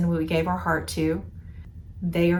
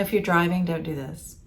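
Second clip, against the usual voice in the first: ordinary talk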